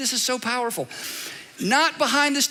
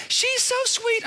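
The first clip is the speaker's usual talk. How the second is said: mock sugary gushing; 13 semitones higher, slower than usual. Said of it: "Everybody's like".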